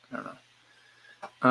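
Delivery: "I don't know" whispered very slowly, breath only; trailing whisper. Real, not acted. Unsure runner drifting off, slow whisper.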